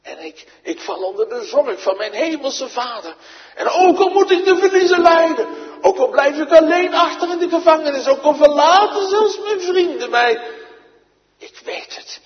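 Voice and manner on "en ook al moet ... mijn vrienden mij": much louder and higher, fast defiant proclamation; preaching shout. Acted. Preacher shouts Paul's defiance to the congregation, loud and high. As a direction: cry it out against every loss.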